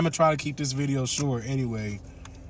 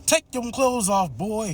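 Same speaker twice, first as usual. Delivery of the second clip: higher, insistent command; mock stern order; 9 semitones higher than usual. Ma insists the boy strip, mock stern. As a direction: lightly play the bossy gun lady.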